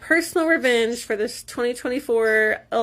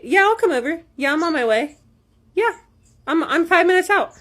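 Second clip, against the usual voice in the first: higher, lilting fake reassurance; string a date along in a higher voice; fake agreeable; 6 semitones higher, 3 dB louder than usual. Acting out replies to dates.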